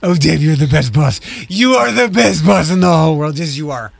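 lightly cooing praise; a hint of talking to a pet; dog talking voice. [name] praising her boss in mild pet-talk. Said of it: "in your Gus and Tucker voice".

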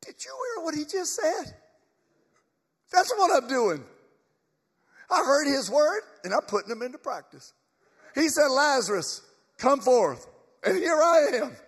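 high, loud, exclaiming risen man; excited Lazarus. Acted. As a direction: proclaim it high and loud to doubting friends. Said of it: "And this is Lazarus".

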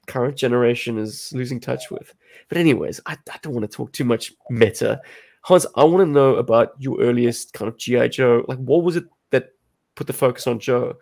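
ordinary talk, nothing marked

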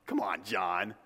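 ordinary delivery

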